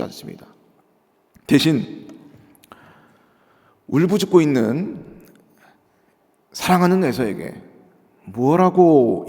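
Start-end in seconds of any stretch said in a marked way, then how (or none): none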